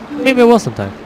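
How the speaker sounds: a hint of a boyish game hero; lightly voiced young hero. Voices the young hero's reply.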